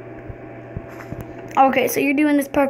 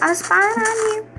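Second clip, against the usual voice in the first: high, sly spy voice; sneaky spy voice; 7 semitones higher than usual. Retold or imitated. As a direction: tease them from hiding. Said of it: "Be like".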